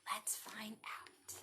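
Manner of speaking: secretive whisper; slow, soft teasing whisper. Acted. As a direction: lean in and whisper. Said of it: "reading to children".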